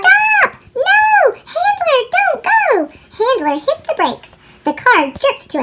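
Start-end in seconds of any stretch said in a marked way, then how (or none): 0.0-3.0 high, loud panicked yell; shouting. Acted. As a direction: scream for him to brake. Olive yells at Handler.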